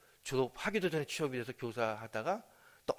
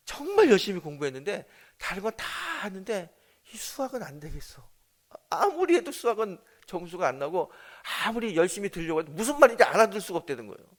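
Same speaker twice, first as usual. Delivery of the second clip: louder, higher, tearful outburst; crying complaint; 7 semitones higher, 10 dB louder than usual. Retold or imitated. Daughter crying to her dad about her math grades. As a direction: wail it out in frustration. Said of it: "그러면서 울면서".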